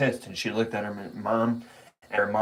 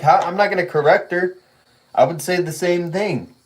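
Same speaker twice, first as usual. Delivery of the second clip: retold protest; 5 semitones higher, 5 dB louder than usual. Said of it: "I'm like".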